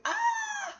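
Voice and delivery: high sharp yelp of shock. Retold or imitated. Ripping the stud out, a high yelp of shock. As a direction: yelp at the sudden pain. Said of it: "the first thing ... feeling is, like, shock".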